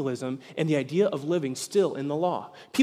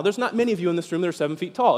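normal talking voice

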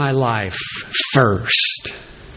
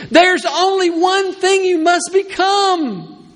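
building preaching; 7 semitones higher than usual. Real, not acted. Preacher building to the congregation, rising to a shout. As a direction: build the list to a near shout.

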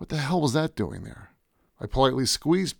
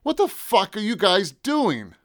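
high, slow, drawn-out yell; 8 semitones higher, slower than usual. A narrator's reading. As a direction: yell it at them, stretching the words. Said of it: "I yelled at them".

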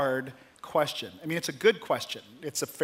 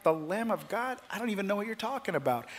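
softer, quick, bewildered question; confused listeners questioning John, soft and hurried; puzzled crowd; 4 semitones higher, 6 dB quieter than usual. Retold or imitated. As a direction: shrug it off as baffled onlookers. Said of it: "And people are like".

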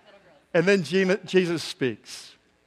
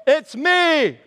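loud, high holler; exaggerated acted shout; mock calling out; 9 semitones higher, 6 dB louder than usual. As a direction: yell it across the water. Acting the unhelpful call.

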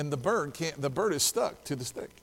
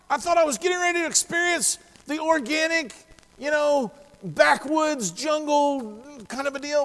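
mock grand; 10 semitones higher, 4 dB louder than usual. Mocking his own grand expectations.

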